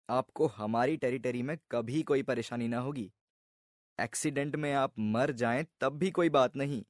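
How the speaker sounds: hushed, earnest salesman's assurances; reassuring insurance officer. Acted. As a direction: soothe the customer like an insurance man giving a little lecture. Voices insurance officer's reassuring speech.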